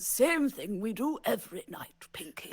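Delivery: hushed grand-actor Brain; breathy McKellen as Brain; Ian McKellen Brain, whispered. Retold or imitated. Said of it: "Sir Ian McKellen's like".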